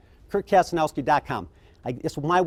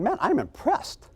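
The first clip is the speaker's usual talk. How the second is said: amazed; 10 semitones higher, slower than usual. Retold or imitated. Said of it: "I'm going".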